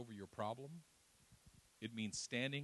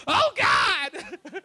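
high shrieking cry with a laugh; comic panicked Moses crying out; desperate cry; 19 dB louder than usual. Acted. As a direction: wail up at heaven in panic. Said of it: "Then he turns to the Lord".

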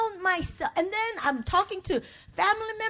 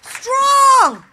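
forceful, rising to a shout on strong; preached shout of strength; loud declaration; 6 semitones higher, 9 dB louder, slower than usual. Acted. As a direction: belt out the word strong.

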